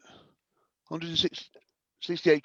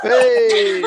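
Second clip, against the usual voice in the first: loud, high cheer with laughter; laughing cheer; 11 dB louder than usual. Real, not acted. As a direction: whoop at the punchline, laughing. Crowd cheers the punchline.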